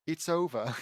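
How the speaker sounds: put-on British accent. Reader redoes Hermione's line in a British accent. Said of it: "also in a British accent".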